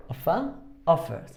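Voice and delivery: OFfer, OFfered, accent up front; stress on first syllable. Shown as a demonstration. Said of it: "está básicamente en la primera sílaba".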